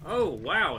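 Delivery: surprised. Real, not acted. Surprised reaction during gameplay.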